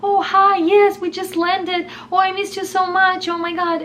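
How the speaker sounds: squealing hello on the phone; gushing Russian lady. Retold or imitated. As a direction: gush like she's thrilled to be home. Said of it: "she's like".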